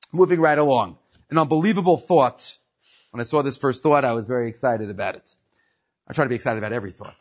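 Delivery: ordinary talk, nothing marked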